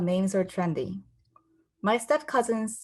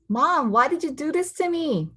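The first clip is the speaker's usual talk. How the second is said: put-on whiny child; 8 semitones higher than usual. Young girl complains to mom.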